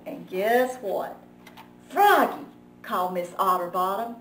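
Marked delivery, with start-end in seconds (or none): 1.9-2.4 high, loud warning call. Acted. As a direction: call him to order. Librarian calling him out.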